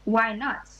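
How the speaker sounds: usual speaking voice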